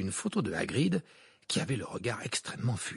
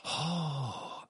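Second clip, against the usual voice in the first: long, hushed oh of wonder; admiring gasp; 3 semitones higher, 4 dB quieter than usual. A narrator's reading. Girls seeing the unicorn, hushed admiration. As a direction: breathe it out slowly in soft admiration.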